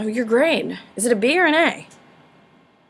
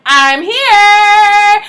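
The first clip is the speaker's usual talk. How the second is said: loud, higher proud arrival shout; triumphant announcement; 8 semitones higher, 11 dB louder than usual. Retold or imitated. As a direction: burst in like a returning hero. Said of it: "And Napoleon's like".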